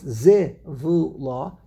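zivula slowly split into zi, vu, la; syllable by syllable. Shown as a demonstration. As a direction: take zivula one syllable at a time. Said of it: "This word has three syllables".

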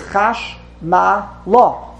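slow Chash, Ma, La with pauses; three separate syllables. Shown as a demonstration. Teacher counting syllables for students, Chash, Ma, La apart. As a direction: give each beat of "Chash Ma La" its own space. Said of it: "This word has three syllables".

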